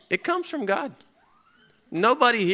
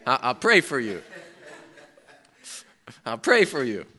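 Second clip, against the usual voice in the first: fake pious reply; 3 semitones lower, 5 dB quieter than usual.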